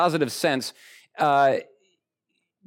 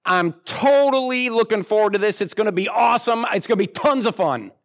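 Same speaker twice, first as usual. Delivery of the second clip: higher, eager voice piling on superlatives; gushing excitement; 6 semitones higher than usual. Retold or imitated. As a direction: gush about the holiday like it's the best thing ever. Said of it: "me and you might do it where it's like".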